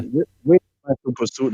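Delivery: put-on repeated line in a lightly done African accent.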